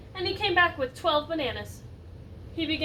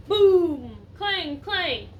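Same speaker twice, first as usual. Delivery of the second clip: loud noise sounds; 3 semitones higher, 8 dB louder, much slower than usual. Acted. Elephant kicking noises.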